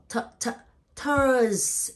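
two short "t" sounds, then a loud, long "Thurs"; sounding out THURS. Shown as a demonstration. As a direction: build "Thurs" up from its first sound. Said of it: "have third sound".

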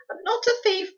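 high, loud tearful cry; boy's cry. A narrator's reading. Boy denying he is a thief, high tearful cry. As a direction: cry it out high, lips trembling. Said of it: "cried the boy".

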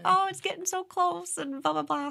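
high, hushed wondering; giddy hopeful whisper; anxious excitement. Retold or imitated. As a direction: fret in a high breathy voice. Retelling anxious waiting for invite.

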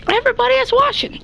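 fast, high hype-man delivery; hyped announcer. Acted. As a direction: pump up the viewers. Hyped intro line.

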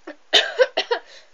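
small, high, covered-mouth cough; polite cough. Retold or imitated. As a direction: cough politely into your hand. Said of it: "Instead of being nice and going".

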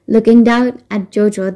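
ordinary delivery

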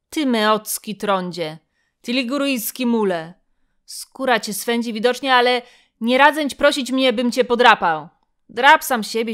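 put-on a cornered old Greek snapping back loudly; angry retort. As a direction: spit the insults back and rise into a shout. Chilo hurls insults at Festus.

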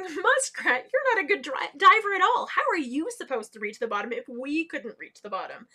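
loud, scoffing diver; mocking laughter. A narrator's reading. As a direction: jeer at the little muskrat. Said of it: "started laughing at her".

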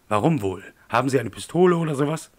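menacing demand. Acted. Attacker interrogates the man on the ground, loud menacing demand. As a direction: bark the questions down at him.

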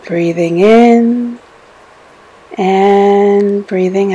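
slow, drawn-out words held to a breath; slow breath pacing. Acted. Meditation guide pacing listeners' breathing in and out, slow and drawn out. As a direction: stretch each cue to match a long breath.

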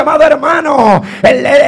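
usual speaking voice

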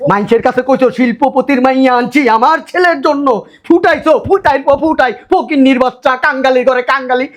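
high, heated mocking rant; scornful tirade. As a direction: throw the boasts back with contempt. Mocks in-law's boasts in quarrel.

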